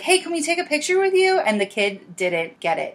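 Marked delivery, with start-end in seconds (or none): 0.0-1.4 eager fan ask. Retold or imitated. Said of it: "they were like".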